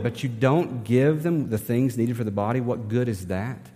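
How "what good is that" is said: ordinary talk, nothing marked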